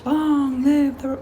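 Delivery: high, drawn-out movie proclamation; joking, slow high-pitched rallying cry; mock Star Wars quote. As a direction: stretch it out high like a hammed-up film line. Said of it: "Star Wars".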